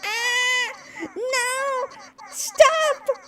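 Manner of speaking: very high, half-laughing wail; squealing protest. Real, not acted. Streamer whose model is going crazy, high wailing protest. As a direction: squeal helplessly for it to stop.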